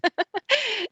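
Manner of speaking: embarrassed high laugh. Real, not acted. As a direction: laughs at herself. Laughs at own mispronunciation.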